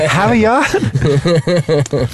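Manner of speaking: mock Boston accent. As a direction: stretch it in exaggerated Boston style. Mocks the stock Boston accent phrase.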